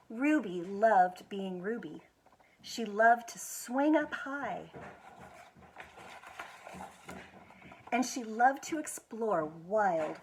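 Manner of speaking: gentle, expressive picture book reading; reading to children. Acted. School foundation director reading a picture book to children, expressive storytelling. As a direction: tell it like bedtime story time.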